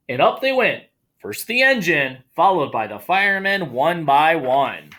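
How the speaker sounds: animated storytime narration; story reading. Acted. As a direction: read it like a children's picture book. Reads picture book aloud.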